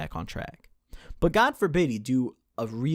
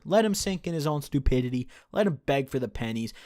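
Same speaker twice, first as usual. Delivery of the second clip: normal talking voice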